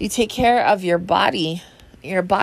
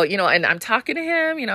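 ordinary talk

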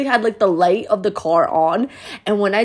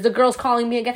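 ordinary talk, nothing marked

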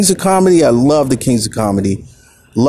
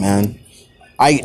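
ordinary talk

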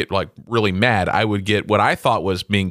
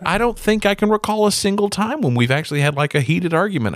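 higher-pitched, lightly voiced puzzled wife; mild mock-innocent voice, pitched up; 8 semitones higher than usual. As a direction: imitate a spouse honestly baffled. Wife's innocent answer, retold.

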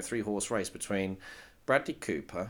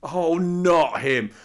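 groan; 6 semitones higher, 7 dB louder, much slower than usual. Real, not acted. Slow high groaning protest.

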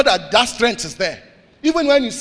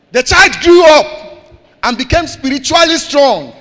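loud scripture reading; 6 semitones higher, 9 dB louder than usual. Real, not acted. Preacher reading Luke aloud to the church, booming. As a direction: proclaim the verse triumphantly.

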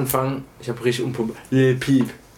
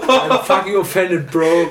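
laughing mock offense; 5 semitones higher, 4 dB louder, much slower than usual. Mock offense over band.

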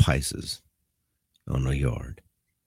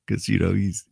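ordinary talk

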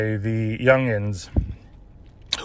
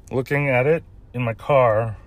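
ordinary delivery

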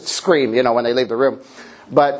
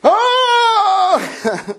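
exasperated yelp. Retold or imitated. Pastor acting an overwhelmed parent's cry, very high. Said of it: "And I'm like".

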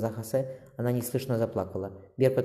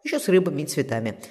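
lifted, higher playful reply; cheerful; 7 semitones higher, 4 dB louder than usual. A narrator's reading. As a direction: answer brightly, joking along. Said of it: "Марика так же весело ответила". (весело, шутливо)

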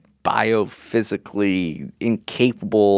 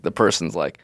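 normal talking voice

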